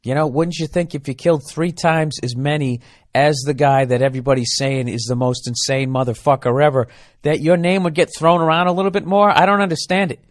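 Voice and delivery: ordinary delivery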